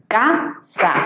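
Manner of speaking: "Casa" in two separate beats, ca, sa; syllable by syllable. Shown as a demonstration. Teacher dividing a word in two, "Casa" syllable by syllable. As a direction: pause between ca and sa in "Casa". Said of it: "al dividirla en sílabas".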